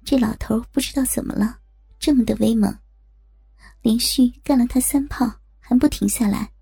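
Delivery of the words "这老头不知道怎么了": usual speaking voice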